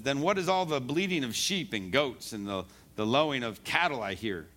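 normal talking voice

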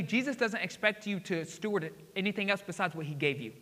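ordinary talk, nothing marked

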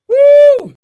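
very high, loud whoop; preacher's whoop. Acted. Preacher celebrating grace before the church, loud whoop. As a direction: shout a joyful whoop.

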